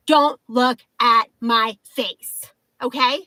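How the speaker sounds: slow, deliberate mock telling-off; firm rebuke. Woman rehearsing what she should have told ID checker, firm rebuke. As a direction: spell it out word by word, slowly.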